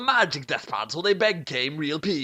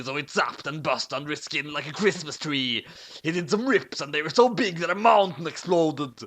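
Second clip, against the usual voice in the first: usual speaking voice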